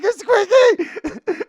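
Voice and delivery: high, loud excited squeal. Retold or imitated. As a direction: shriek the name with joy. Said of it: "And I was like".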